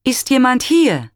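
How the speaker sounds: calls out high and loud into the woods; calling out. A narrator's reading. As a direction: shout a searching question across the forest.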